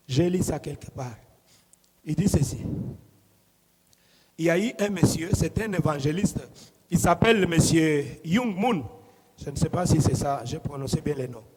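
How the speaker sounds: ordinary talk, nothing marked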